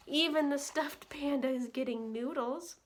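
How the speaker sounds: gentle, lilting read-aloud for little ones; bedtime story reading. A narrator's reading. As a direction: read it like a cozy bedtime book. Reading picture book to children.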